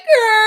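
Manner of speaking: loud, high imitated wailing noise; mock wail. Commentator mocking the husband's earlier crying, mock wail. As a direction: belt out an exaggerated fake wail. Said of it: "you was just wailing".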